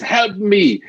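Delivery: high, loud cry for help, slowed down; a trapped man calling up to strangers, pleading; desperate plea. Retold or imitated. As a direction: beg from the bottom of the pit. Man in pit begs third passerby.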